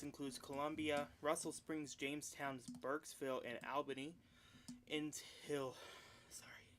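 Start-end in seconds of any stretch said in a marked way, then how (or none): 6.3-6.7 winded, gasping between words; catching breath mid-sentence. Real, not acted. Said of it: "I'm out of breath".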